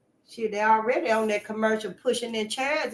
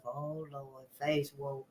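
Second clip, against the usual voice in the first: muttered dread; 8 semitones lower, 16 dB quieter, slower than usual. Retold or imitated. Family groaning at her.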